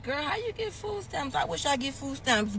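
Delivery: a light imitation of a jealous friend; mock envious friend. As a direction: tease how they ask it. Mimicking envious people.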